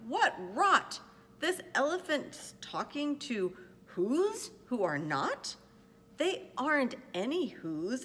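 loud, punchy monkey bluster; scornful bullies yelling in a read-aloud; storybook shouting. A narrator's reading. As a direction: shout it indignantly, hitting the key words. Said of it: "The Wickersham brothers came shouting".

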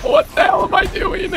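very high strained squeal; squealed dismay. Real, not acted. Gamer baffled by his own blunder, squealing. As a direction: squeak it out in disbelief, pitch far up.

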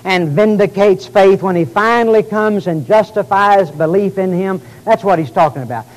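rising preacher cadence, loud and emphatic; preaching. Acted. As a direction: preach it with full conviction. Preacher expounding scripture.

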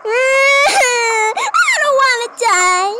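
high, loud hammed-up kid sob; fake-cry like a scared child; mock child crying about dying; whimpering child.

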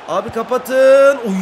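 drawn-out, loud, pleading shout; desperate shout. Real, not acted. Gamer yelling at his defenders to mark Mbappe, loud and desperate. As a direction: yell at the defenders to close down.

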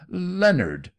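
a high, loud screamed name; shrill scream. A narrator's reading. Aunt screaming at her nephew in panic, high and loud. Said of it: "she screamed shrilly to her nephew".

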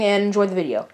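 ordinary talk

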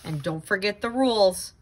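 plain reading voice

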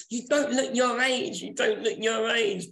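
singsong quoting of others; mock compliment. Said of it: "Everybody keeps saying".

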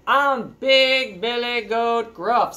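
loud, very slow, weighty announcement; big goat. Acted. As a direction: boom the name out word by word. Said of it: "said the goat".

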